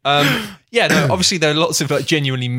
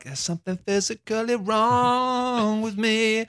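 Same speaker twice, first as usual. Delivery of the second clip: delighted; 10 semitones higher, much slower than usual. Retold or imitated. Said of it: "I was like".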